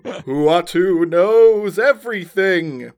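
slow booming mystic; grand mystic voice. A narrator's reading. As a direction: proclaim it like an all-knowing sage. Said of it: "The voice interrupts you".

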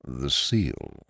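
low, quiet, sad imprisoned voice; sad muffled voice. Acted. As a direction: sound shut in and sorrowful. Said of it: "said in his sad, imprisoned voice".

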